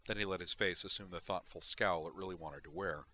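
usual speaking voice

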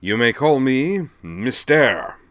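loud, slow, high hero proclamation; grand announcement. A narrator's reading. Masked man grandly introduces himself, slow and loud. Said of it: "drew himself up grandly and announced".

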